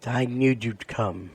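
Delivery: ordinary talk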